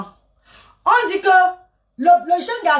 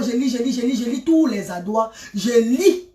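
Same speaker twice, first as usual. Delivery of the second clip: usual speaking voice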